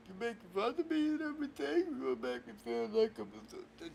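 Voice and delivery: soft, high tearful complaint; hurt whining. Acted. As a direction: whine it as the wounded victim. Upset, accuses host of mocking.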